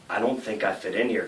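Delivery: low, very soft retold confession; imitating a friend quietly owning up; meek admission. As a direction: drop to a small, quiet voice.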